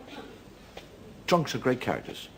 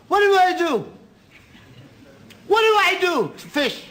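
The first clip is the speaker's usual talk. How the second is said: put-on high, loud bewildered drunk; clueless drunk; 5 dB louder than usual. Novice drunk asking his friend how to fish, high helpless voice.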